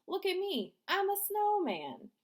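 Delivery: high, loud clowning shout; goofy call-out. Acted. Danny shows off to classmates, high goofy call. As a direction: show off to the class as a snowman.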